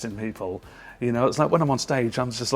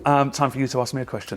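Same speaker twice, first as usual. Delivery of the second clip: ordinary talk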